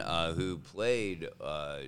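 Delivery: ordinary talk, nothing marked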